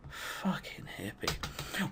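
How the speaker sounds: soft unvoiced whisper; sneaky muttered jab; whispered aside. Real, not acted. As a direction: breathe it under your breath.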